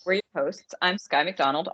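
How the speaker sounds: ordinary talk, nothing marked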